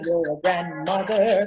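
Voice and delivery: ordinary delivery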